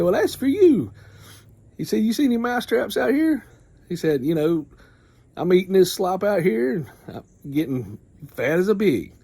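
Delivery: a pig bragging about his slop; smug pig. Retold or imitated.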